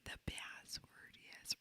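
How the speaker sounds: hushed creepy whisper; Jim Carrey whisper. Retold or imitated. Cohost imitating the movie character, whispering. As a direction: whisper it right in the ear. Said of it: "he goes".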